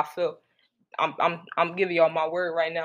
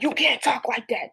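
higher-pitched put-on voice of a disapproving listener; mock scolding critic; 7 semitones higher, 3 dB louder than usual. Imitating a listener who scolds his cursing, prim and higher. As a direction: play the prude who tells him off, in a prim put-on voice. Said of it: "somebody probably be like".

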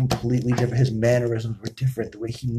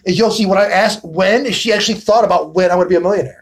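excited; 6 semitones higher, 6 dB louder than usual. Real, not acted. Excited praise of her answer.

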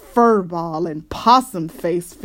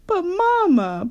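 put-on high, pleading child's protest; 11 semitones higher than usual. A narrator's reading. Sister to scolding Mama, high protest. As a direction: whine it up high. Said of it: "protested Sister".

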